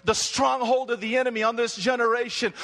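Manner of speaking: ordinary talk, nothing marked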